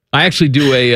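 ordinary delivery

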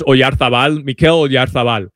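name said with Castilian Spanish sounds; Spanish accent, lisped z as th. Real, not acted. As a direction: say the name like a Spanish match announcer. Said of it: "I'm saying it with a Spanish accent".

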